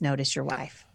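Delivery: Notice your wife, dropped low and soft. Shown as a demonstration. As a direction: bring it down soft, with weight on notice. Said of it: "Say that again".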